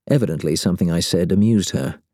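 usual speaking voice

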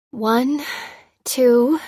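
a patient counting through her fear; counting nervously. A narrator's reading. The patient counts aloud, nervous. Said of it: "she counted nervously".

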